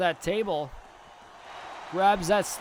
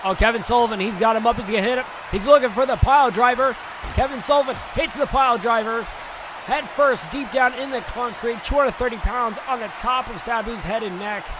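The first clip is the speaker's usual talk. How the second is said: excited play-by-play; 4 semitones higher than usual. Acted. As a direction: shout the big moves as they land. Live wrestling play-by-play.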